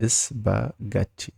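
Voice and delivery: "Spaghetti" very slowly as is-ba-gat-ti; syllable by syllable. Shown as a demonstration. Teacher showing learners the word, syllable by syllable. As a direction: split "Spaghetti" into slow separate beats.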